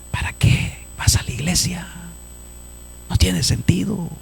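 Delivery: hushed, nearly voiceless crowd voice; discouraging murmur. Acted.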